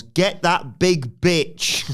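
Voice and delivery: high, loud, slow yell; drawn-out mock holler; imitated yell. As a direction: yell it out word by word, hammed up. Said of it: "Storm yells".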